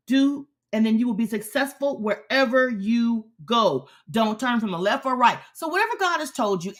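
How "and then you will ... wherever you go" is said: normal talking voice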